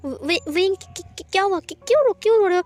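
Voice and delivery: acted panic, tripping on questions; panicked stammering. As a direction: stumble over the words in rising alarm. Panics over partner's tears.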